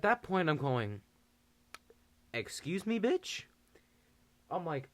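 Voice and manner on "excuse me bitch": mock indignant. Narrator indignant at snitching.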